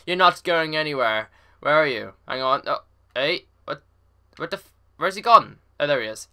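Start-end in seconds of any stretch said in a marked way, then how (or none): none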